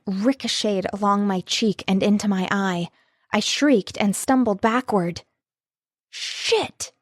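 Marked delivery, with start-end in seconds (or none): 6.1-6.9 higher, breathy shocked swear; shocked curse. A narrator's reading. Rafe sees her get hit, shocked curse. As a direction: blurt it out in alarm.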